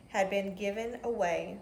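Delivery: plain reading voice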